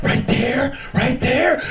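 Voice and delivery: high, rising "right there" moans; hammed-up rising moan; rhythmic moaning. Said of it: "She's like".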